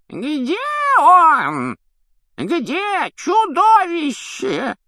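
put-on old man shrieking in shrill falsetto; loud, drawn-out, very high squawk of outrage; screeching falsetto. A narrator's reading. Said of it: "Тишину взорвал визгливый фальцет дряхлого члена комиссии".

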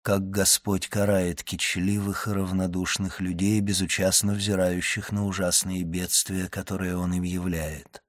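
soft, subdued, a little low; composed, almost unchanged voice. A narrator's reading. Count confiding in his friend after regaining self-control, composed and subdued. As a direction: hold the feeling in, speaking softly. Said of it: "сказал он почти неизменившимся голосом".

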